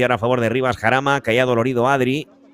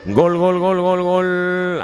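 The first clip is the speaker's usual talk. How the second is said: rising to a long high gol; goal scream; 9 semitones higher, much slower than usual. Real, not acted. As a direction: stretch the gol out, thrilled. Penalty scored, goal call.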